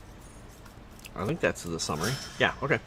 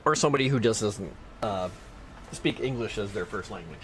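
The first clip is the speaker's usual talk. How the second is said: usual speaking voice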